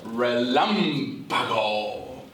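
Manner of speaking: "relámpago" said slowly, stress on "lám"; slow Spanish demo. Shown as a demonstration. Host repeating the word of the week slowly for viewers.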